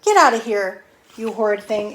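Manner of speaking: loud angry shout; furious Granny. Acted. Granny furiously yelling at the wolf to get out. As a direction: throw him out in a fury. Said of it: "she was furious".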